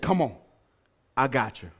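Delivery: very soft, low reassurance; quietly steady a panicking swimmer; calm voice. Retold or imitated. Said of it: "swam up in a calm voice".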